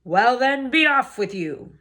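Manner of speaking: loud troll dismissal. Acted. Troll waving the little goat away, loud. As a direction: send him off gruffly.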